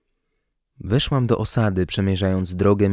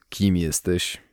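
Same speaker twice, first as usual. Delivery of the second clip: icy tone; 2 semitones lower, slower than usual. A narrator's reading. Bearded man to a stranger, mildly icy tone. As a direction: ask it coldly and with restraint. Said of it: "Lodowaty ton sprawił".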